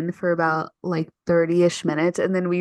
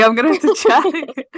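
laughing; 8 semitones higher, 6 dB louder than usual. Real, not acted. Laughs about being online.

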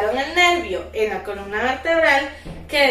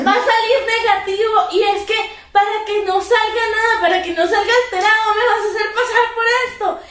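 higher, heated protest with laughs in it; arguing protest; 6 semitones higher than usual. Retold or imitated. Patient arguing with the doctor in the ER, heated. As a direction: keep fighting the doctor's orders. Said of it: "que yo peleando".

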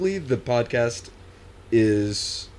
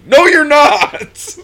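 laughing shout; 13 semitones higher, 11 dB louder, much slower than usual. Real, not acted. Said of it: "laughing outburst at movie".

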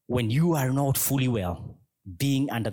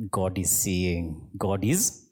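slow, measured warning; mild menace; 4 semitones lower than usual. Acted. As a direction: warn them slowly, with a touch of threat. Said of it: "as a sense of threat".

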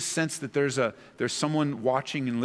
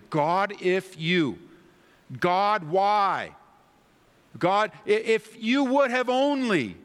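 raised higher and louder, slow and pleading; angry, frustrated prayer; 8 semitones higher, 5 dB louder, slower than usual. Pretend. Believer crying out at God in frustration, higher and louder. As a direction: cry out at God in frustration, pitch climbing. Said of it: "maybe you're angry and you're frustrated".

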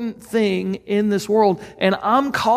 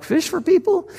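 mock disbelief, pitched much higher; a startled, doubting echo of the call; incredulous; 8 semitones higher than usual. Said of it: "a lot of us that are like".

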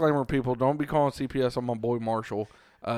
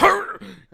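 loud, high strangled noise; sudden choked sputter, acted out; mock choking gasp; 5 dB louder than usual. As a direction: imitate losing your breath in a chokehold. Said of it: "the immediate loss of breath".

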